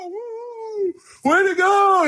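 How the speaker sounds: very high excited whoop; retold whooping cheer. Coworkers cheering an award winner, high whooping cheer. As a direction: cheer like the whole team won. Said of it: "all fifty of us going nuts".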